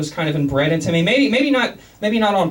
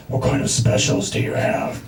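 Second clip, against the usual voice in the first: low whispery Batman growl; 5 semitones lower than usual. Retold or imitated. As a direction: rasp it like a caped vigilante. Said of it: "the Batman voice".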